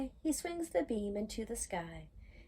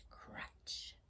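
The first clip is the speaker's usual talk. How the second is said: crunch breathed with no voice; whispered crunch; 16 dB quieter than usual. Acted. Reader voicing the spilling load, whispered crunch. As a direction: hush the sound effect. Sound word in story.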